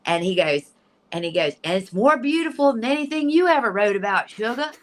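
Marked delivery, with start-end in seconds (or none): 1.6-4.7 high, excited imitation of a father; playful imitated Southern dad; dad teasing. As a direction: tease fondly in a high, marvelling voice. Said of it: "My dad was also very funny".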